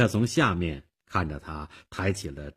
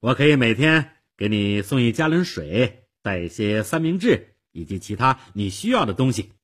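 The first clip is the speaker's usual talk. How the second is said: put-on self-assured girl, louder than usual; confident; 5 semitones higher, 7 dB louder than usual. A narrator's reading. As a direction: offer it with easy confidence. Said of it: "少女很自信地说". (很自信地说)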